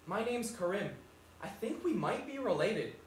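quiet imitation of a posh kid; soft little posh accent. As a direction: mimic a small, well-spoken posh boy softly. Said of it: "he's got this posh little voice".

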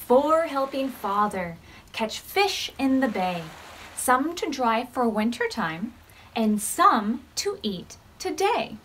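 gentle storybook read-aloud; reading to children. Acted. Teacher reading a counting story to the class, gently. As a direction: read it like story time for little ones.

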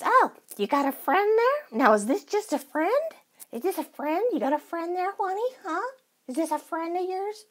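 high, nosy mock-jealous questioning; hammed-up jealous teasing; mocking jealous friend. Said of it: "Mumble Bear says".

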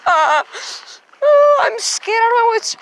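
high, whimpering mock fright; mock scared. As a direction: play it scared and shaky. Mock fear in the rink.

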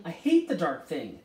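loud, forceful outburst; passionate cry. A narrator's reading. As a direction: declare it passionately and loudly. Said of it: "Charles Wallace cried passionately".

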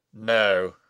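low, loud and firm; firm refusal. Acted. Abbot resists the wizard's temptation, firm refusal. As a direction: refuse with full, dropped weight. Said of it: "answered firmly".

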